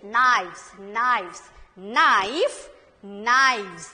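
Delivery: usual speaking voice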